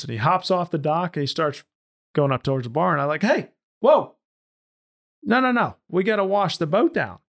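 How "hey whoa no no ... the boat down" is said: raised, higher-pitched call to halt; calling him back. Retold or imitated. Skipper to new owner heading for the bar, calling him back.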